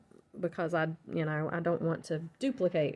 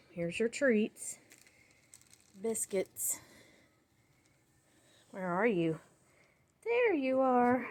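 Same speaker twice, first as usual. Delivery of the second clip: talking to a dog; 3 semitones higher, 5 dB quieter than usual. Real, not acted. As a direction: coo gently at the pup. Talking to her dog Sadie.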